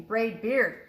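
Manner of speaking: ordinary delivery, nothing marked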